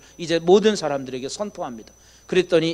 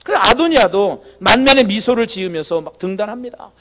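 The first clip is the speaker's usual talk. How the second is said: normal talking voice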